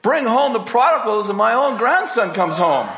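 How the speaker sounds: high, exclaiming preacher's climax; impassioned marvelling. Real, not acted. Marvelling climax of grandson's story.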